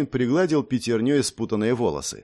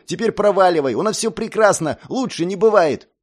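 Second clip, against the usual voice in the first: higher, louder angry shout; 7 semitones higher, 5 dB louder than usual. A narrator's reading. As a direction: shout him away. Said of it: "Не кричи".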